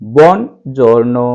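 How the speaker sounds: बुongiorno drawn out very slowly; repeat-after-me drill; slow model word. Shown as a demonstration. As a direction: stretch बुongiorno for students to copy. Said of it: "मेरे साथ में बोल करके".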